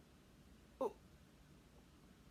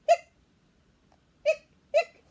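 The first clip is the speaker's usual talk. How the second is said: hiccup sounds; 19 dB louder, slower than usual. Acted. Skeleton hiccuping.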